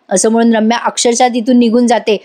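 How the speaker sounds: ordinary talk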